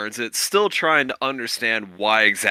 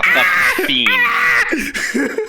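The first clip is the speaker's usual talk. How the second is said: laughing shout; 5 dB louder, much slower than usual. Real, not acted. GM laughing at a wild climb.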